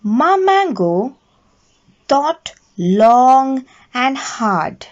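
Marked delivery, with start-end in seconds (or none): none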